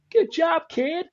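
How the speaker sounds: high, loud American-accented praise, imitating Lewis; hammed-up American accent, patting a rookie on the back. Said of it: "giving Lewis an American accent".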